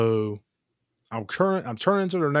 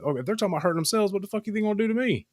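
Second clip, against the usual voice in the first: fast worked-up rant; 10 semitones higher, much faster than usual. Real, not acted. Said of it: "rant at fast pace".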